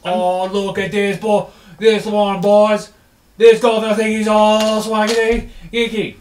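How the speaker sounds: high, loud mock-British yob; jeering barbarian. Barbarian mocking the cleric to the boys, jeering. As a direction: crow mockingly to the lads.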